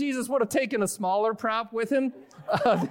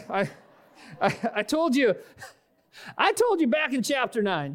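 exasperated reminder, pitched up; mock-scolding Jesus, higher voice; frustrated; 4 semitones higher than usual. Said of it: "he's like".

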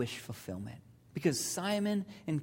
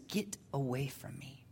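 hushed, much softer plea; recoiling Simon; 3 semitones lower, 10 dB quieter than usual. Acted. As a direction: drop to a hush, pushing him away. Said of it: "his first reaction is".

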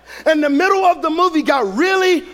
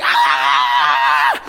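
long hammed-up scream; mock horror wail; wife's panicked scream; 13 semitones lower than usual. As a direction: imitate a panicking wife screaming at the movie. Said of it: "as she's panicking".

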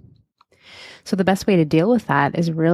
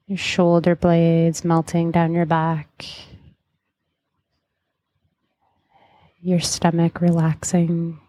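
guided meditation. Acted. Leads a hypnotic guided meditation.

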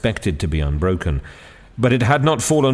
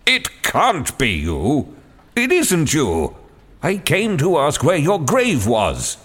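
higher, amazed protest; higher, slow astonished exclaiming; staggered disbelief; 7 semitones higher, slower than usual. Acted. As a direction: cannot believe who he sees. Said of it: "the exclamation of a man utterly staggered".

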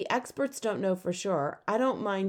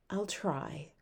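much softer, subdued; said quietly; 2 semitones lower, 7 dB quieter than usual. A narrator's reading. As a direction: give in softly, deflated. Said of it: "Claudia said quietly".

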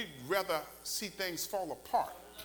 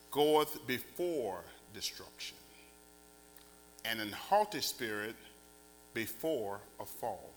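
scripture reading; 7 semitones lower, 4 dB louder, slower than usual. Acted. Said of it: "but listen to this".

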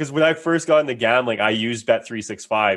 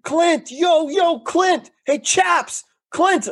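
much higher mock yell of a name; calling out across the mall; much slower than usual. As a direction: call after him from a distance. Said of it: "this guy just starts screaming at me".

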